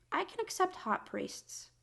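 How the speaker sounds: high-pitched, excited squeak. Real, not acted. Building comic list.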